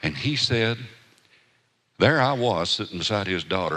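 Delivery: ordinary delivery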